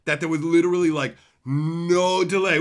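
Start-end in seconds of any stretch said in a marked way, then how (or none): none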